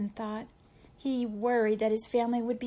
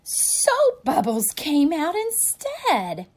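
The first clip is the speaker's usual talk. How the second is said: gasp, then slow louder reveal; surprised reveal; 6 semitones higher, 8 dB louder, slower than usual. A narrator's reading.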